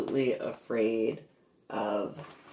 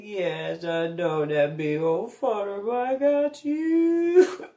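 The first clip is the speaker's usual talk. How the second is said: higher, slow cooing; baby talk to dog; 6 semitones higher, slower than usual. Retold or imitated. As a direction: coo to comfort the puppy. Soothing dog.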